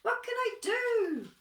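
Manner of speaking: high, loud, fast panicky bird; worried kookaburra. Acted. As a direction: fret for your friend in a squawky panic. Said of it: "He's like".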